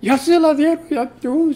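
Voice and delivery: high excited retold cry of joy; excited praise. Sister Toña rejoicing over the form, high and excited. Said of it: "la semana gritaba y alabando a Dios".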